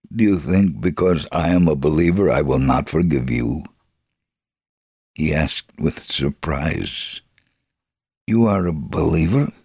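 ordinary talk

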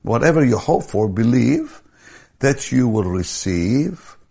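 high, loud, building sermon cry; preaching at full force. Acted. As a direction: drive the verse home to the crowd. Preaching climax, rising to full force.

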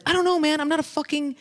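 high-pitched exasperated retort. Retold or imitated. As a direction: retell a fed-up protest, pitch way up. Retold quarrel with taxi driver.

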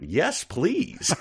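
mock-eager, high-pitched acceptance; excited. Kids answer the offer to hear the kora, excited. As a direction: pipe up like thrilled kids. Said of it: "said with excitement".

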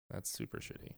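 hushed unvoiced aside; whispering. Real, not acted. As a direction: whisper it under your breath.

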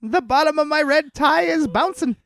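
high, fast little leprechaun voice. Retold or imitated. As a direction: chirp it like a gleeful leprechaun. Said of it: "You sound like a little leprechaun".

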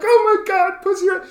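put-on breathless teen excitement; giddy fifteen-year-old. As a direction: gush like a teenage girl spotting gossip. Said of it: "we were like two fifteen-year-olds".

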